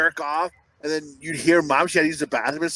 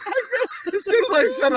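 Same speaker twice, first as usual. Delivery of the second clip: 1 whiny apology. Real, not acted. Pleading after bad joke.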